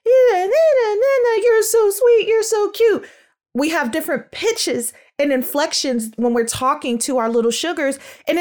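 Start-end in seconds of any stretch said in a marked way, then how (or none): 0.1-3.1 put-on much higher, louder sing-song baby voice; baby talk. Shown as a demonstration. Parent cooing sweetly to a baby. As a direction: coo at a baby in goo goo gaga voice. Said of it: "that little goo goo gaga baby voice".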